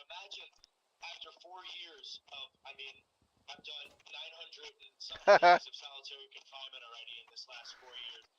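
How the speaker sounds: ordinary talk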